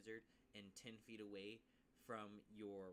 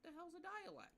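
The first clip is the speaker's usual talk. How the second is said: much higher, put-on puzzled voice; confused player. Player baffled by the rules, high confused squeak. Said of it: "you're probably sitting over here like".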